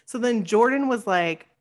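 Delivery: ordinary delivery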